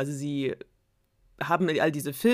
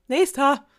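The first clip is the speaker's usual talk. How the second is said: annoyed call; 10 semitones higher, 9 dB louder than usual. Acted. Princess dismissing suitors.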